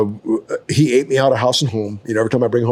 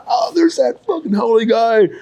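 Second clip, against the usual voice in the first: high-pitched mimicked taunt from a gang of kids; jeering laughing kids; 11 semitones higher than usual. West Side kids spotting an outsider, jeering. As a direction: imitate them in a much higher voice. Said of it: "They're all, like, laughing, going".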